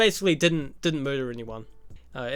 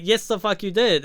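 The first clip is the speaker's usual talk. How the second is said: retold angry retort; 6 semitones higher, 5 dB louder than usual. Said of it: "And she's like".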